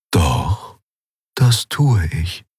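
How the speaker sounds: slow, drawn-out ghostly mentor; calm spirit. A narrator's reading. Mentor's spirit answering agitated Kai, slow and calm. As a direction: answer slowly, unmoved by the outburst.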